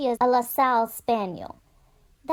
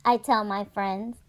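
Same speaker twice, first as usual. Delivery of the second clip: plain reading voice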